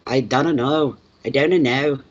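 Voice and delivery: light Scottish "I don't know" with clipped vowels; a Glasgow man shrugging it off; mock Glasgow Scottish. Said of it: "They go".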